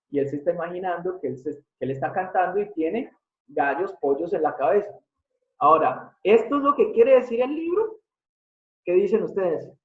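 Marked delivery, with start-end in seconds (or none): 6.2-8.0 high, rising, disbelieving question; teasing the class with a higher, louder incredulous ask. Shown as a demonstration. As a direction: ask it as if the idea were absurd. Teacher poses a mock-incredulous question.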